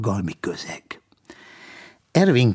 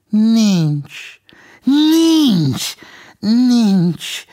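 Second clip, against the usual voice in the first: three Nincs, rising high and louder, then settling; repeated denial; 11 semitones higher, 5 dB louder, much slower than usual. A narrator's reading. Ervinke denies owning anything, each nincs higher and louder. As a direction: pile up the denials, the middle one peaking.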